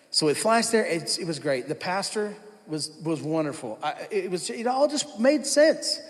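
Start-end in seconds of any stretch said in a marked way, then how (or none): none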